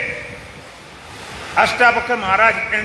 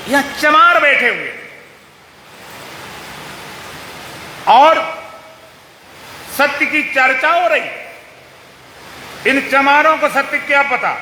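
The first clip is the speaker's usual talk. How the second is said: mildly indignant, raised-pitch scolding; indignant rebuke; 5 semitones higher than usual. Acted. Ashtavakra berating scholars who claim to discuss truth, mild acted indignation. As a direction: scold them with pointed disbelief.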